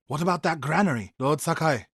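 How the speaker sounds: ordinary talk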